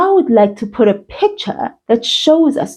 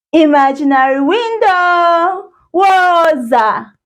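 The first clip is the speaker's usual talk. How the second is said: calling out; 7 semitones higher than usual. Acted. Class calls the window together.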